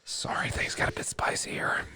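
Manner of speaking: hushed, voiceless aside; whispering. Pretend. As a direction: drop into a playful whisper. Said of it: "I'm just whispering it".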